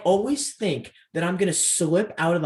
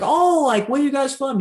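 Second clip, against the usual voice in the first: high, loud, gushing curiosity; chirpy girl impression; 9 semitones higher, 5 dB louder than usual. Girl from Michigan meeting two strangers, high and gushing. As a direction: put on an eager girl's high voice.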